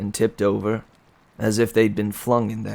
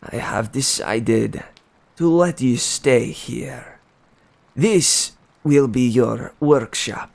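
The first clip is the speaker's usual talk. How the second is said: a king handing down a sentence he enjoys; gloating cruel king; slower than usual. Acted. The king tells Daedalus he will stay as his captive, slow and gloating.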